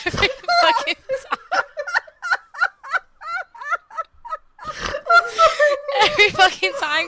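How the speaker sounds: high, squeaking through laughter; laughing. Real, not acted. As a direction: gasp out the words laughing. Laughing fit.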